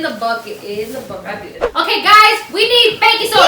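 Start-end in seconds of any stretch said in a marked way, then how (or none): 1.6-3.5 loud, high announcement; calling out. Real, not acted. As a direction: call it to the whole room. Calling out to the kids.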